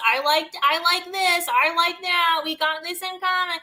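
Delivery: high, gushing imitation of shallow friends; mock enthusiasm. Mimics shallow friends bonding over likes.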